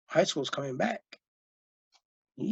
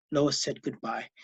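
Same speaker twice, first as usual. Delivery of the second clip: ordinary delivery, nothing marked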